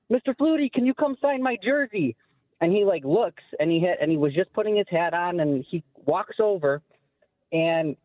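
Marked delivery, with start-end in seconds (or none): 0.1-2.1 put-on high, eager little-kid plea, clearly higher; retelling his eight-year-old self begging for a signature; excited kid voice. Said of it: "I was really excited to see Doug".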